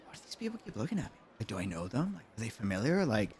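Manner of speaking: hushed, quick self-questioning; retold private wondering, very quiet; puzzled inner thought. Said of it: "And I was like".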